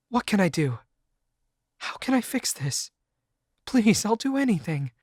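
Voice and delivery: high pleading voice; desperate plea. A narrator's reading. Girlfriend desperate to fix things, high pleading.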